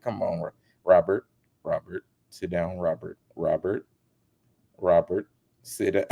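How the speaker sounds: a hint of low, firm scolding; scolding interviewer. Interviewer calming a celebrity, lightly voiced scolding. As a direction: talk him down like a child. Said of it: "Like she was scolding a little kid".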